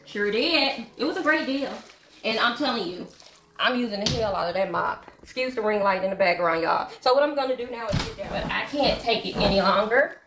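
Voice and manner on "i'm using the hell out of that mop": normal talking voice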